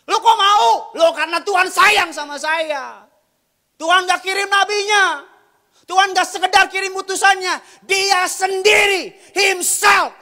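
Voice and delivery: high, loud sermon shout; loud, high-pitched rhetorical preaching; full-force preaching. Real, not acted. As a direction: drive the point home to the congregation.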